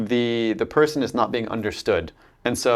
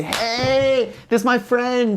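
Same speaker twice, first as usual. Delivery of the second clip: much higher, bright overfriendly hello; gushing greeting; 12 semitones higher, slower than usual. Retold or imitated. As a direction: greet like an old best friend, way over the top. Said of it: "and I say".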